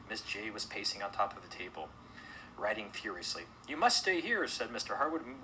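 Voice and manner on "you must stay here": high, loud insistence; urgent command. Acted. Host ordering panicked guests to stay, urgent command. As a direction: insist over a panicking crowd.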